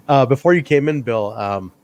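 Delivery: ordinary talk, nothing marked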